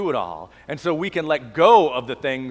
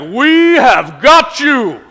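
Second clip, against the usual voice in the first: imitating Swanee; 10 semitones higher, 12 dB louder, much slower than usual. Imitating big Swanee calling out at camp, booming slow shout. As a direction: boom it out like a big guy yelling across camp. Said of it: "he would be shouting out like".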